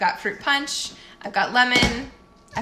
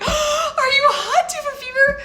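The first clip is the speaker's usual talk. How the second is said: put-on a mother alarmed at a sick child; replaying her own panic; mom freak out; 6 dB louder than usual. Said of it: "total mom freak out moment".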